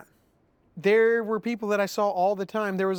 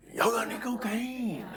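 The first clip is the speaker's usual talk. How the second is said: big local guy; 5 semitones higher than usual. Retold or imitated. Big local guy asking the band, imitated higher and hushed. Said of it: "big old local guy".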